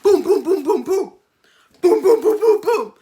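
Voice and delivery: loud, high rapid booms; imitated door banging. Woman banging on his door, loud imitated thumps. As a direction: pound out the knocks with your voice.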